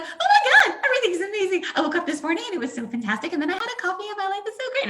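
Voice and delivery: high, loud, fast mock-gushing chatter; fake cheerful gushing. Mocking people who pretend everything is amazing, high and gushing. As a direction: gush like a fake-positive person, all sunshine. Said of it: "people who talk like that".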